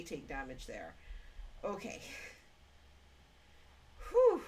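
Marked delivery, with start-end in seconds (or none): 4.1-4.4 loud, high whew of relief after a fright; relieved whew. Real, not acted. Player startled by a companion taking damage, loud relieved whew. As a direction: blow out a big relieved whew. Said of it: "Giving me a fright".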